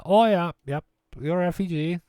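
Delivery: put-on voice of a dad shrugging it off; dad's breezy reply. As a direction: imitate dad in a high, loud voice. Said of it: "He's like".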